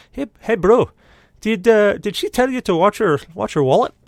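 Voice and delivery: put-on high, loud Scottish accent. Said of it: "still kicking into the Scottish thing".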